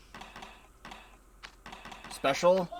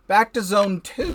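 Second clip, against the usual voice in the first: excited; 8 semitones higher, 6 dB louder than usual. Real, not acted. Excited heading back.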